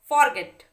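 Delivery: Forget very loud and emphatic, weight on गेट; forceful teaching demo of forget, second syllable stressed; stress on last syllable. Shown as a demonstration. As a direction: drive home गेट in forget. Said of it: "ई पे पड़ रहा है".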